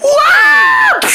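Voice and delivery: a high shocked cartoon shout; shocked what. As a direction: squeal it out in disbelief. Shocked reaction.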